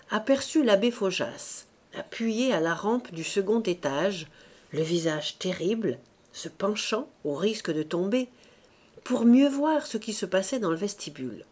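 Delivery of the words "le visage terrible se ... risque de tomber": ordinary delivery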